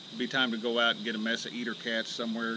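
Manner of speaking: usual speaking voice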